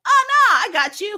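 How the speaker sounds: high, loud mock-eager reassurance; mocking Kayla's overeager offer, loud and high. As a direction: chirp it like an overeager helper. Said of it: "Kayla's like".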